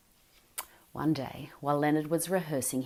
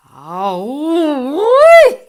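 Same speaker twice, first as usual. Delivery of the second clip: very high, loud yelp; a fox yelping as it flees; fox scream; 11 semitones higher, 20 dB louder than usual. Acted. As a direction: shriek in pain. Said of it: "screamed the fox".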